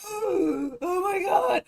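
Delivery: gasping high and loud, slowly drawn out; a loud, slow, shocked Oh my God; chat's stunned reaction, mock gasp. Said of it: "everybody in the chat was like".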